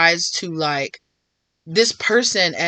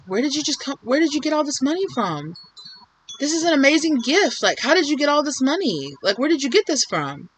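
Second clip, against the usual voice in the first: higher, wide-eyed surprise at the gift; amazed disbelief; 8 semitones higher than usual. Retold or imitated. Relative receiving money asks where it came from, amazed. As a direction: gasp out the questions in delighted shock. Voicing a stunned grateful relative.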